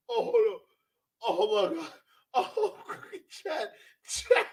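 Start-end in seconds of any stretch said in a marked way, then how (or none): 2.3-4.5 high, soft squeaks through laughter; overcome with laughter. Real, not acted. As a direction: barely get it out for laughing. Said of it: "this is too funny".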